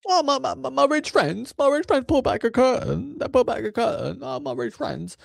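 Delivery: high squeaky sneering voice; mocking rich friends. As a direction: sneer it in a silly falsetto.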